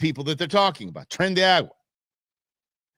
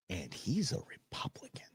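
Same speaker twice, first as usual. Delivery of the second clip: retold in a hushed whisper; whispered gossip; 2 semitones lower, 16 dB quieter than usual. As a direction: mimic her secret whisper. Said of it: "She was".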